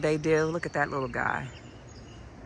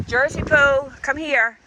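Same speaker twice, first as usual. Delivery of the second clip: louder, higher call across the yard; calling the dog; 9 semitones higher, 9 dB louder, slower than usual. Real, not acted. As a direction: call the dog to come back.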